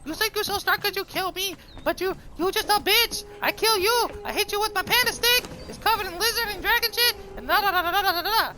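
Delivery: very high-pitched mock trash talk; cocky cartoon panda-warrior. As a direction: taunt a rival in a squeaky cartoon voice.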